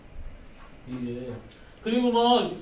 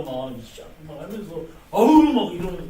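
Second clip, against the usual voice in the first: mock angry huff; 3 semitones lower, 7 dB louder than usual. Acting out angry Moses.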